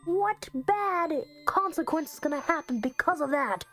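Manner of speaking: high-pitched, exasperated rant; exasperated scolding. Real, not acted. Player scolding the character for skimming the book, exasperated rant.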